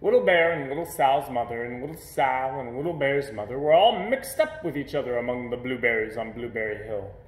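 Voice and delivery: usual speaking voice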